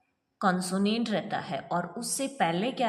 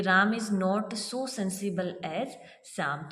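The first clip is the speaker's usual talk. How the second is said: slow dictation; much slower than usual. Shown as a demonstration. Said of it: "इस तरह से कि".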